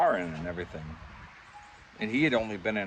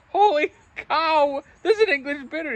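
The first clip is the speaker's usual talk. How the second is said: amazed delight; 10 dB louder than usual. Retold or imitated. Thrilled at the bar over the English bitter, delighted amazement. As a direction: gasp it out like you can't believe your luck.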